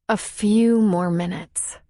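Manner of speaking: plain reading voice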